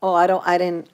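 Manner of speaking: ordinary delivery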